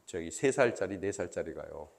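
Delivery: ordinary delivery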